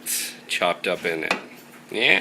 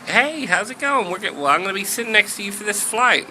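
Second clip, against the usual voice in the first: very high, quick Southern chirp; high-pitched Southern friendliness; chatty Southern lady; 11 semitones higher, faster than usual. Acted. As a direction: gush to a seatmate in a high Southern voice. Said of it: "She's from the South".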